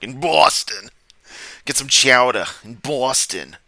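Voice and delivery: loud, high mock Boston accent. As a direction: ham up the Boston drawl. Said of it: "the accent really is kinda charming".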